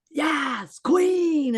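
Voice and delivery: high, loud cheer; high cheering yes queen; cheering fan. Retold or imitated. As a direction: hype someone up like a fan. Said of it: "because we go".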